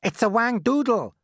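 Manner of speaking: high, loud alarmed yell. A narrator's reading. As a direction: yell it out high over the crowd. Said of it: "yelled the head".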